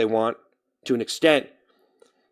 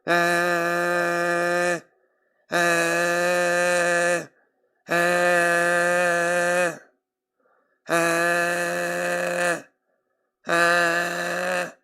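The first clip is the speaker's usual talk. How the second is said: sustained ah; 2 semitones higher, 4 dB quieter, much slower than usual. Acted. Drawn-out vocal warm-up.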